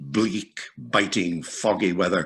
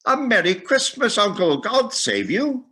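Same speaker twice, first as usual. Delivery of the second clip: bright, much higher, louder greeting; cheerful cry; 12 semitones higher, 3 dB louder than usual. A narrator's reading. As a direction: call out a merry greeting. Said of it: "cried a cheerful voice".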